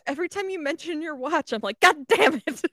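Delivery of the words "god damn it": louder, higher exasperated outburst; retold irritation. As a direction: act out being fed up. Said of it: "sometimes you make me irrationally angry".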